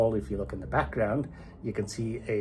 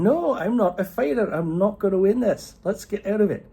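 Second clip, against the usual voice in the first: panicky husband; 11 semitones higher, 6 dB louder than usual. Acted. Angus panicking about the fight.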